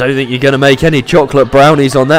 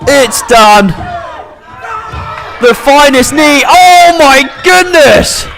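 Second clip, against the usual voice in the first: wrestling commentator; 11 semitones higher than usual. Acted. Live wrestling play-by-play.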